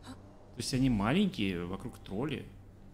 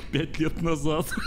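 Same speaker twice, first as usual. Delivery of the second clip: slow, louder words through laughter; amused disbelief; laughing; 5 semitones higher, 5 dB louder, slower than usual. Real, not acted. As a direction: laugh it out slowly, higher. Laughing at the timeline.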